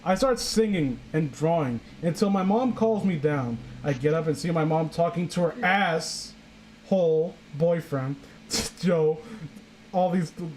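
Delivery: ordinary talk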